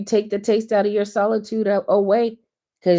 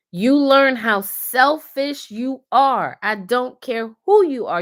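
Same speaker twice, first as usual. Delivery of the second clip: slow, high, louder preaching; emphatic preaching; 6 semitones higher, 5 dB louder, slower than usual. Real, not acted. Speaker preaching to viewers about selfishness, slow and high. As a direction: drive it home like a sermon.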